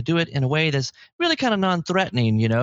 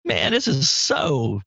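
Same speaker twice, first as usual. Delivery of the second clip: stretched, then pitched way up on so wrong; indignant outburst at the radio; mock outrage over Christmas music ending early; exasperated rant; 7 semitones higher, slower than usual. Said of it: "I was like".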